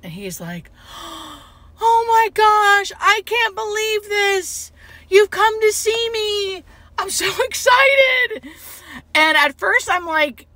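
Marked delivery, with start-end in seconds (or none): 0.9-1.4 short gasp of happy surprise; delighted gasp. Retold or imitated. Patient's delighted surprise at seeing her. 1.8-8.5 high, loud, drawn-out thrilled cry; overjoyed excitement. Retold or imitated. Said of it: "his eyes light up".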